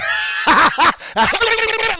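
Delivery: high convulsive exaggerated cackle; seizure-like laugh. Drunk man laughs at the joke, convulsive cackle. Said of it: "like he really had one".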